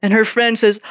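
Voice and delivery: ordinary talk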